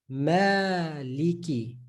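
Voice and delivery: "maliki" stretched slowly with a long first ma; slow drawn recital. Shown as a demonstration. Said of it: "this is the word we all know".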